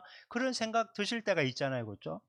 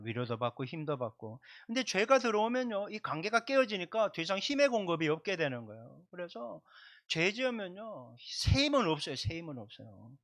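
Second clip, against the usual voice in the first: ordinary delivery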